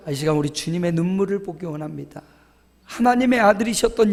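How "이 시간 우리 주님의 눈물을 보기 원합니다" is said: normal talking voice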